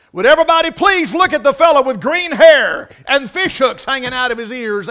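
loud, high, hammed-up maitre d' calling out to the room; mock announcement. Maitre d' presents the green-haired guest, mock grand announcement. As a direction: announce it grandly to the whole restaurant. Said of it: "the maitre d' announced".

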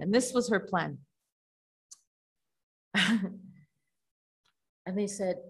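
normal talking voice

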